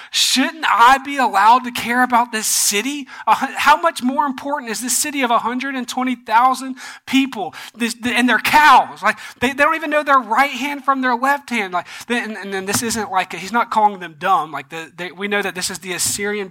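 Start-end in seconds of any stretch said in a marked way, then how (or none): 0.1-11.7 raised, higher voice arguing for mercy; acted-out indignant rhetorical questioning; impassioned appeal. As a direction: plead the case with insistent emphasis. God pleads for the city.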